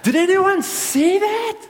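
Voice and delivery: squeaky high, slow call to the crowd; a high, drawn-out cry of disbelief; excited squeak. Retold or imitated. Boy calling out to the crowd at the netball court, squeaky and high. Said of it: "I was like".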